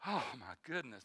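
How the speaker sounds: weary sigh, hushed. Pretend. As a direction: let the breath out on the words. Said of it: "just almost sighing to God".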